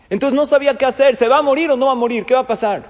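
put-on desperate mother fearing for her child; anxious panic. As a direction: rush the words like a frantic mother. Hagar panics over dying son.

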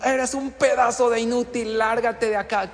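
high, loud insulting shout; unhinged boss screaming at the candidate; acted yelling boss. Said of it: "empezar a gritar y a insultar".

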